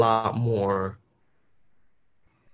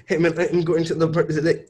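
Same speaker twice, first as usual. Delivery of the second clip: fast, flowing, higher; quick legato; 7 semitones higher, 3 dB louder, faster than usual. Shown as a demonstration. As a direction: rush it out smoothly. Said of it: "It's like".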